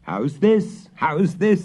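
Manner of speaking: high stern question; stern father. Acted. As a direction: demand an answer. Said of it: "looking sternly over the girl's shoulder".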